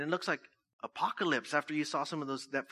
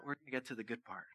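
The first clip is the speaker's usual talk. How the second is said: ordinary talk, nothing marked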